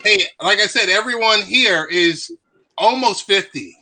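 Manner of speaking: ordinary talk, nothing marked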